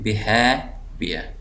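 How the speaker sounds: slow behaviour, हे drawn out; stress on HAVE. Shown as a demonstration. Teacher marking the stressed syllable, long हे in behaviour. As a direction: stretch हे before wear in behaviour. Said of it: "ज़ोर दे रहा हूँ".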